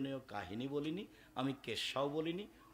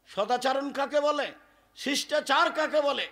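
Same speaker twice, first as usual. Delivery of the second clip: rousing rhetorical questions; 9 semitones higher, 8 dB louder than usual. Acted.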